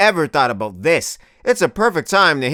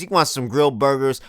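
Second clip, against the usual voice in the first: ordinary talk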